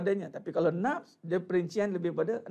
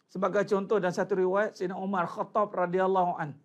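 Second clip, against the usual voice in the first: normal talking voice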